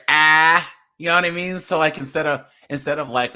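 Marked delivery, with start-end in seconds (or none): 0.1-0.7 "ah" drawn out, loud and open; Boston A sound. Shown as a demonstration. Explaining Boston vowels, long loud "ah". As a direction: hold a broad, r-less "ah". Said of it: "all the A's are".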